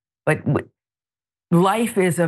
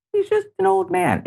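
sarcastic mock defense. Real, not acted.

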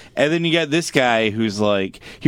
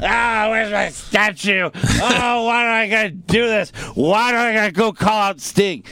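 nasal whine; 9 semitones higher than usual. Retold or imitated. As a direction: whine it like a spoiled brat. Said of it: "He whined the whole fucking show".

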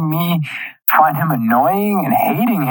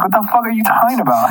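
mock outraged yelling at the TV; outraged rant; 8 semitones higher, faster than usual. Fan yelling at Jan on screen, high indignant rant. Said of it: "And I was like".

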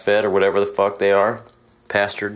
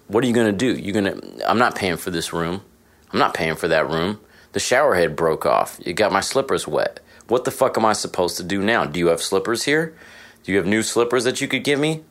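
ordinary talk

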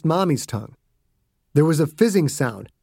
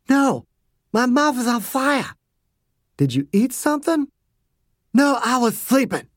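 higher, irritated woman's voice; upset mom; 9 semitones higher than usual. A narrator's reading. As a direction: snap back at dad, in pain. Mommy burning mouth, irritated.

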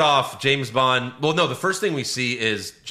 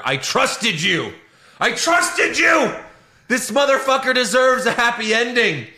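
retold betrayed yell; 12 semitones higher, 4 dB louder than usual. Fan yelling at the director in the theater, hurt and loud. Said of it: "I was so pissed off".

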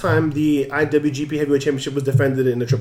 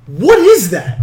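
baffled question; 7 semitones higher, 8 dB louder, much slower than usual. Retold or imitated. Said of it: "He goes".

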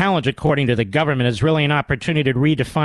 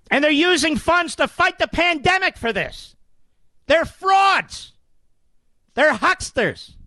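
heated rant; 10 semitones higher than usual. Real, not acted. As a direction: rail at the listeners, voice climbing high. Host's rant against school plan.

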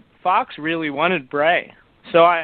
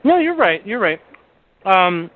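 talking while chewing a cracker; mouth full; 2 semitones higher than usual. Real, not acted. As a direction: speak around a mouthful of cracker. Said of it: "I'm eating a cracker in your ear".

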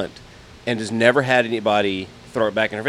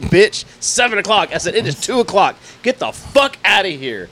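higher, louder angry shouting; retold yelling; 9 semitones higher, 4 dB louder than usual. Ordering the neighbor out of his garage, loud yelling. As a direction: yell her off the property.

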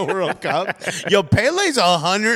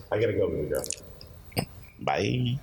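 low, hushed goodbye to wife; soft phone goodbye; 8 semitones lower, 8 dB quieter than usual. Real, not acted. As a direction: quietly hang up on her. Ending call with wife.

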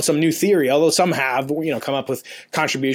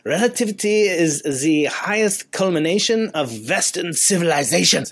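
imitating a colleague's German accent, pitched up; lightly put-on German accent, slower at the end; 4 semitones higher than usual. Retelling a late colleague's saying in a German accent. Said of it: "in his German accent".

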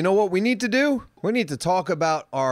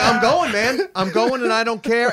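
excited; 7 semitones higher, 4 dB louder than usual. Retold or imitated. Retelling his carefree trimming.

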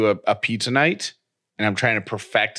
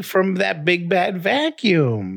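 put-on baby voice; 6 semitones higher than usual. Voicing baby thanking mom.

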